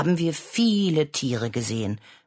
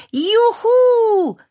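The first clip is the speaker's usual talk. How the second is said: high, loud cry of delight; joyful shriek; 9 dB louder than usual. Acted. Child cheering after hearing about the zoo trip, joyful shriek. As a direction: squeal for joy like a small child. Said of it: "schrien ich und meine Schwester Clara vor Freude".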